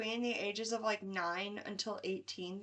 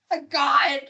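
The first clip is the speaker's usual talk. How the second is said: loud, higher exasperated outcry; mock dismay; 5 semitones higher, 14 dB louder than usual. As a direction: play the friend appalled by the question. Acted reaction of an offended friend.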